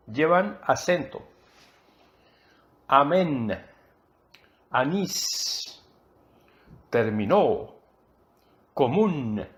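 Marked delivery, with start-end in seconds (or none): 2.9-9.5 Amén, anís, terminó, común with each final syllable drawn out and stressed; stress on last syllables. Shown as a demonstration. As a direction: teach the stress: a-MÉN, a-NÍS, termi-NÓ, co-MÚN, one word at a time. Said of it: "llevan el acento o el énfasis". (Amén, anís, terminó, común con énfasis alargado en la última sílaba)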